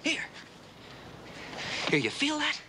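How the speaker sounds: coaxing her to feel it, excited; eager. Real, not acted. Eager teaching breakthrough.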